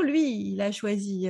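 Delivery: ordinary delivery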